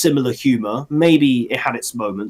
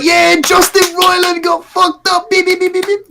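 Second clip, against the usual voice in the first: mock shouting quote; 5 dB louder than usual. Host voicing a passing car that agrees, mock shout. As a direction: shout it as a hammed-up cheer, then squeal the beeps. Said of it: "They was like".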